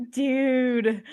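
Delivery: drawn-out California "dude"; lightly hammed-up Californian dude; mock California dude. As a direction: stretch it like a movie Californian. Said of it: "We just say dude a lot from California".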